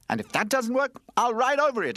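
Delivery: very high, loud boast; bold skateboard. Acted. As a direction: brag about the attack. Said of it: "added Skateboard".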